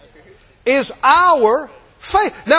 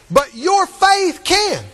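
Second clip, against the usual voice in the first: preaching climax; 3 semitones higher, slower than usual. Real, not acted.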